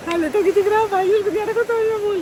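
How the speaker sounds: laughing at her own failure. Real, not acted. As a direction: laugh through the words. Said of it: "इतनी हंसी आ रही है".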